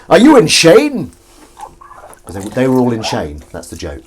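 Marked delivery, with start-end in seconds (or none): 0.1-1.1 high, loud, slow mock Connery; bad Connery impression punchline; Sean Connery. Shown as a demonstration. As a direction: ham up a Scottish film star. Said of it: "I'm gonna do the Sean Connery voice".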